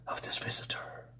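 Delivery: a ghostly whisper fading out; whispered trailing end. Acted. Spirits whisper about the unknown visitor. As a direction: let the line die into a whisper.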